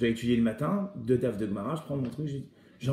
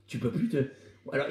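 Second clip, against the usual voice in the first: normal talking voice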